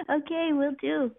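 ordinary talk

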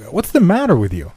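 high, urgent exclaimed question; crying out. A narrator's reading. Friend asks Davidson what's the matter, crying out. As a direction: cry it out in alarm. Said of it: "cried I".

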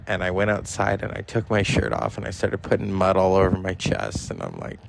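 normal talking voice

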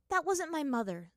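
high-pitched, mildly firm denial; resolute. Acted. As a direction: insist on it with resolve. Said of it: "said Azula resolutely".

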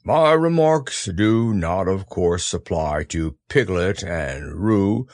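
slow, low solemn donkey; grand, gloomy speechmaking; pompous Eeyore speech. Acted. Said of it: "in an impressive way".

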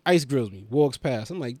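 normal talking voice

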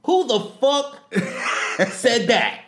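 high, loud, slow sergeant shout; hammed-up military yell; Sergeant Hartman. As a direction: demand the culprit like a drill sergeant. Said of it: "Sergeant Hartman".

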